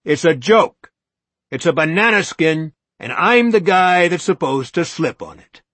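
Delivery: high, loud, ranting accusation; rising courtroom tirade, high and loud; courtroom shout. Acted. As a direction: hector them like a lawyer in court. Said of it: "His voice began to rise toward a courtroom shout".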